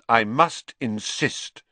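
slow firm. Acted. Dumbledore firm.

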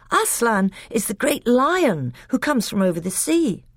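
put-on a child amazed; eager little boy. A narrator's reading. Caspian answers his uncle, surprised and boyish.

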